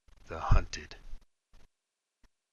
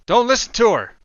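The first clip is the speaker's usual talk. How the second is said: much higher, louder yell; 13 semitones higher, 13 dB louder than usual. A narrator's reading. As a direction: shout down the woman. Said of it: "One of the men yells".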